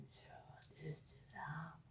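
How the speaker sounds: hushed secretive whisper. Retold or imitated. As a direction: drop to a breathy whisper as if sharing a secret. Whispered aside about tests.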